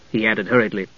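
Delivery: ordinary delivery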